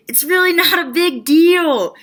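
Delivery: high, loud, drawn-out announcement; mock big reveal. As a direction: proclaim the secret with exaggerated force.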